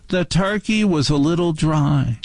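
imitated critical aunt. Quoting Aunt Martha to explain the dishes, imitated criticism.

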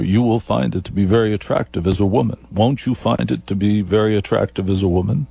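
normal talking voice

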